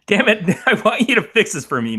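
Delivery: loud, high, laughing demand; mock outrage. Podcaster demanding co-host fix things, mock exasperation. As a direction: playfully throw a loud tantrum.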